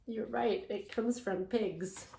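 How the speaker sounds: usual speaking voice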